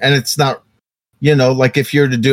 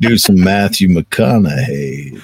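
low, slow mock Southern drawl; 6 semitones lower, slower than usual. Joker announcing a celebrity impression, low Southern drawl. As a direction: stretch it out with a light Texan twang.

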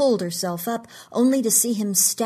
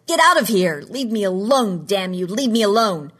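loud, raw shouting; furious yell; 2 semitones higher, 7 dB louder than usual. A narrator's reading. Trevor yelling at Sumner to leave him alone, loud. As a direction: drive them out with full-voiced rage. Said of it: "He opened his eyes and yelled".

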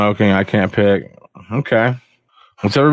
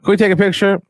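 much higher eager fan voice; fan asking; 10 semitones higher, faster than usual. Retold or imitated. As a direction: ask for a photo, high and eager. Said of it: "Uh, can I help you?".

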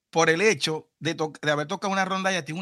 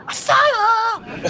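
mock high note; much slower than usual. Shown as a demonstration.

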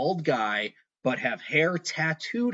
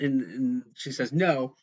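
usual speaking voice

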